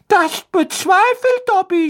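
very high squeaky elf voice, hesitant; a timid little elf struggling for words; nervous house-elf. A narrator's reading.

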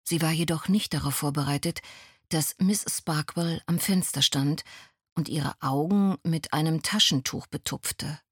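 usual speaking voice

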